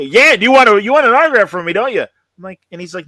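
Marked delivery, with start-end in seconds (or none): 0.0-2.0 louder, higher, pushy celebrity imitation; pushy autograph offer. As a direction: press the kid hard, mock-eager. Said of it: "he really pressured him".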